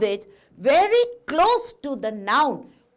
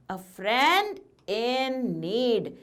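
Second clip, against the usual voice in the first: slow, pointed answer; slower than usual. Shown as a demonstration. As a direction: say "A friend in need" slowly, each word drawn out. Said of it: "What kind of a friend?".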